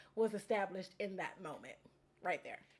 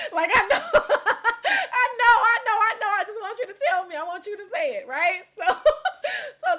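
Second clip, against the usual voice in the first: laughing; 9 semitones higher, 9 dB louder than usual. Retold or imitated. Laughing retelling of knowing glee.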